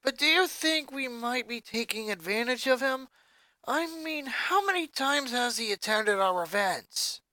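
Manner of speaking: lightly voiced teen rocker, concerned; a hint of a concerned rocker girl; worried rocker. A narrator's reading. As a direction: ask earnestly, a bit uneasy.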